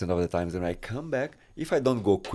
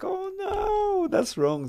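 much higher, playful dismayed cry; high mock dismay; much slower than usual. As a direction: squeak out the mock protest. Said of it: "and I was like".

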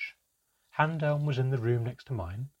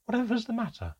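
much higher, trembling worry; quavering voice; 13 semitones higher than usual. Acted. Mother finding her crying child, quavering. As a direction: let the voice shake with worry. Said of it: "in a quavering voice".

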